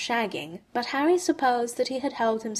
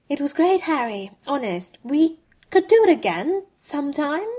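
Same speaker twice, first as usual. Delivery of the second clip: eager reassurance; 7 semitones higher, 4 dB louder than usual. A narrator's reading. Bert reassures Harry after sex.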